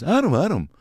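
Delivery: high-pitched imitation of the rock star. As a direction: call out lightly in an imitated high voice. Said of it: "he goes".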